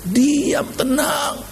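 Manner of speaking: high, ringing held words; preaching. Real, not acted. Preacher giving the Lord's command, high ringing preaching. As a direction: call the command out high and sustained.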